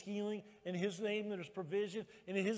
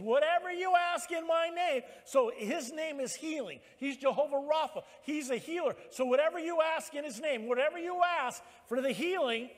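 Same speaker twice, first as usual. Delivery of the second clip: raised, loud pulpit preaching; full-force preaching; 8 semitones higher, 6 dB louder than usual. Acted. Preacher urging the church to ask for healing, full-force preaching. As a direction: preach it high and loud, driving the promise home.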